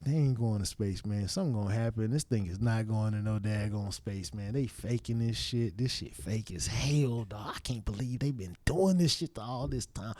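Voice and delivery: low, quiet muttering to himself; muttered thoughts. Retold or imitated. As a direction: grumble it under your breath, suspicious. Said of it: "I'm sitting there thinking".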